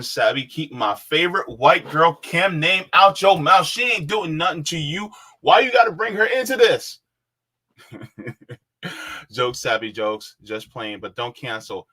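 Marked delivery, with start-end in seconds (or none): 0.0-7.0 high, loud, indignant [name] voice; [name] impression. Reading a viewer's joke, mock [name] outburst. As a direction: defend her loudly like [name]. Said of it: "my best [name] impression".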